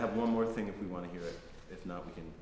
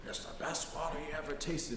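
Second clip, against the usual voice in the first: a lightly voiced proud old man, a bit softer; proud old farmer; 4 semitones higher, 5 dB quieter than usual. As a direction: offer it with quiet pride. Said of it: "And he'd say".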